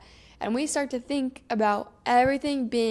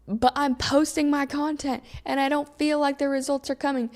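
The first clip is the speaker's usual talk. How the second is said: ordinary talk, nothing marked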